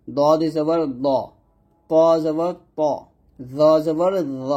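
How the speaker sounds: দ, ত, জ each with a heavy rounded o; thick heavy vowels. Shown as a demonstration. Teacher models letters with zabar, thick and heavy. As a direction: spell out slowly, ending thick on দ, ত, জ. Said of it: "এই সাতটা হরফের উপর যখন জবর".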